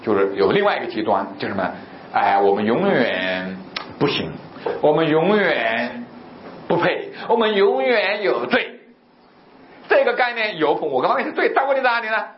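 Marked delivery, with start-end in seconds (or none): 2.1-8.8 slow, drawn-out penitent's lament; mock self-condemnation. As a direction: drag out the words as a hopeless sinner. Voicing self-condemning believers. (拖长音模仿自责哀叹)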